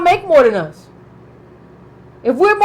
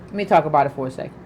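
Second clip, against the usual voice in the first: quieter, faster and lower, trailing away to herself; fading mutter; 11 semitones lower, 7 dB quieter, much faster than usual. Shown as a demonstration. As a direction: let it fade out under your breath. Says the same line three times.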